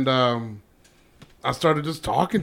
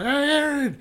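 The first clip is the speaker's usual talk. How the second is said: rowdy band shout; 12 semitones higher than usual. Retold or imitated. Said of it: "and he's like".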